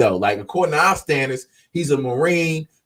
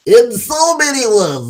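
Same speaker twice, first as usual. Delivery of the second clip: slow, much higher mock Russian accent; 11 semitones higher, 5 dB louder than usual. Warning listeners off Russia in a put-on Russian accent. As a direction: drag it out in a hammed-up Russian accent. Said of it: "Russians sound like that to me".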